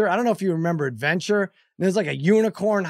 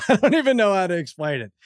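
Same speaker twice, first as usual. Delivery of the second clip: laughing through the words, incredulous; can't explain it for laughing; 2 semitones higher than usual. Real, not acted. Dad describing the awful Atari game, laughing.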